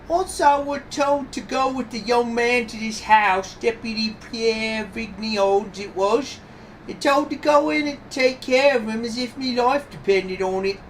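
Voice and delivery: high-pitched Cockney witness; Cockney accent. Acted. Witness telling the judge of her orders, high Cockney. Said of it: "this is the Cockney accent here".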